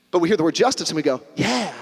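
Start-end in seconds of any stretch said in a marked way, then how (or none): 1.4-1.7 pleased, drawn-out yeah; approving. Acted. Congregation hearing the word justice, approving. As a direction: welcome the word with easy approval.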